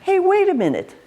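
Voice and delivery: high incredulous question; amused, high-pitched challenge; surprised. Retold or imitated. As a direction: raise the pitch in disbelief. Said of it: "it was so amazing".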